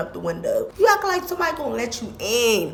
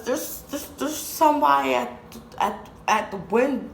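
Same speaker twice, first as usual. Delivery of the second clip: halting, higher, stuttering alarm; stammering scared kid; 5 semitones higher than usual. Retold or imitated. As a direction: stumble over the words in a mild fright. Scared kid waking cousin.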